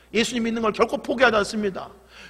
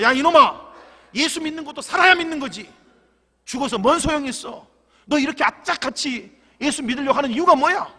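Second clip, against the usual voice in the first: furious grandfather; 5 semitones higher, 3 dB louder than usual. Acted.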